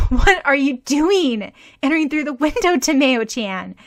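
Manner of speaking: stare in disbelief; a girl shocked at a friend climbing through the window; aghast question. A narrator's reading.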